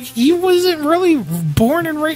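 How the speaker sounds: high, slow pushback; high disbelieving objection; exasperated protest. Retold or imitated. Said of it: "And I was like".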